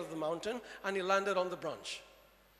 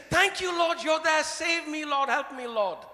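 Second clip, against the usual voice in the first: desperate plea; 6 semitones higher, 6 dB louder than usual. Acted. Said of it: "And he said".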